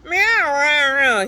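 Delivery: very high, loud angry cat yowl; a long loud falling cat screech; nasty yowl. Acted. Cat sound list, horrible meaning.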